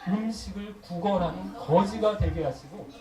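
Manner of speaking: loud, solemn recitation of a cursing prayer. Retold or imitated. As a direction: read each curse out firmly, leaning hard on the key words. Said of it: "저주를 하는". (저주하는 기도를 힘주어 낭독하듯)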